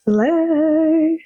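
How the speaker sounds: hyped slay. Real, not acted. Vlogger cheering the teacher reveal with a hyped slay. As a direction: pop the word with delight. One-word hype reaction.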